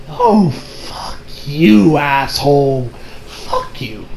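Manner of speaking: very slow, hushed swearing; acted disgust, sinking into a low, soft whisper; whispered curse. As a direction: curse under your breath, dragging it out. Acts own disgusted reaction.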